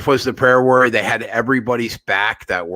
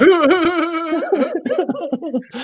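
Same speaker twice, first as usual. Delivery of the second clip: high-pitched laugh. Real, not acted. Mocking his husband remark, high squealing laugh. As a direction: cackle high at the joke.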